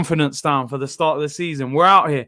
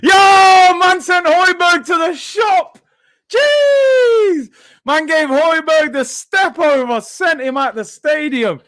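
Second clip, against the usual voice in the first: much higher and louder, slow and drawn out; excited shouting; 10 semitones higher, 5 dB louder, slower than usual. Real, not acted. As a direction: rave about the step-over at the top of your voice. Raving about a skill move.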